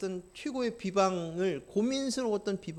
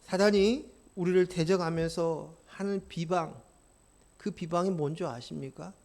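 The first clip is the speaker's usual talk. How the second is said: ordinary delivery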